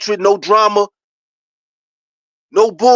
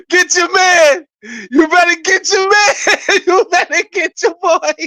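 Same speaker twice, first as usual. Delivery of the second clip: excited hype; 7 semitones higher than usual. Real, not acted. Teasing Scotty about his big contract, high laughing hype. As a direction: cheer it out between laughs.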